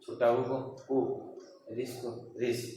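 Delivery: normal talking voice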